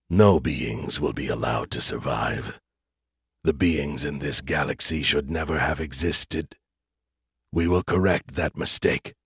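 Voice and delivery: cold alien voice. Acted. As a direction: deliver a cold death sentence. Alien creators speak.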